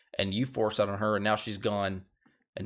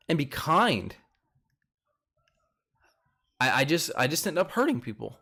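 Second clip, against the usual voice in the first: higher-pitched mock-upset lament; imitated tearful plea; 6 semitones higher than usual. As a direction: act out her hurt, without real tears. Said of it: "she's crying, and she's like".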